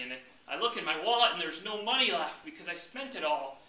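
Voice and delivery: ordinary talk, nothing marked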